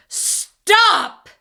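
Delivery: sharp high shout. A narrator's reading. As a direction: shout it out to stop them. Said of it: "shouted Jasper".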